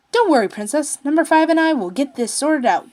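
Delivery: put-on a bright old mechanic promising a fix; cheery reassurance. Coran reassuring the princess, chipper and loud.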